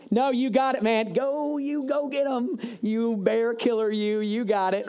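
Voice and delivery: high, slow, hammed-up fake encouragement; sarcastic cheer. The king sending David off, with hammed-up sarcastic cheer. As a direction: cheer him on with mock enthusiasm. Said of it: "here's kind of my version of it".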